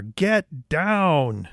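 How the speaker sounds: mild imitation of a warning yell; retold yell. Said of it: "he yells to her".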